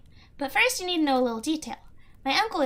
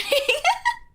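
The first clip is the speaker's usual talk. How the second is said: giggle; 6 dB louder than usual. Real, not acted. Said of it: "I can't take myself seriously".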